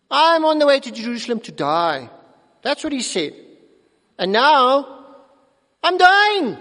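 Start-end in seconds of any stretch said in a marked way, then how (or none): 0.1-2.1 louder, forceful announcement; bold declaration. Acted. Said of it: "Jesus speaks with a victorious". 4.2-6.6 high, loud triumphant cry; victorious loud voice. Acted. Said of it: "speaks with a victorious voice".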